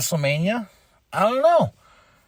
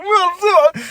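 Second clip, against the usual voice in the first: much higher, louder mock-defensive no; hammed-up offended comeback; indignant retort; 13 semitones higher, 5 dB louder than usual. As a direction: squeak out a hurt denial. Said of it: "I was like".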